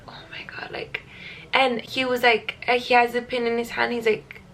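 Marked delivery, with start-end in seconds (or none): 0.1-1.0 hushed, alarmed whisper; panicked whisper. Retold or imitated. Mother whispering as the birth certificate comes in, panicked. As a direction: whisper it under your breath in panic.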